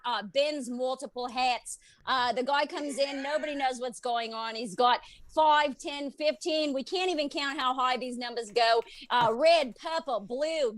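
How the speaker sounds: put-on Aussie live reporter; Australian accent. Shown as a demonstration. Comic shows the host her practised Australian accent, reporting live. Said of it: "show off her Australian accent".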